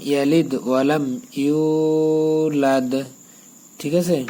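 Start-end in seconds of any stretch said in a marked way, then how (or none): 1.4-2.9 ইউলাদ with a long stretched a, slowly; slow, drawn ইউলাদ; drawn yulad. Shown as a demonstration. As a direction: stretch ইউলাদ one alif. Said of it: "এখানে এক আলিফ টান হবে".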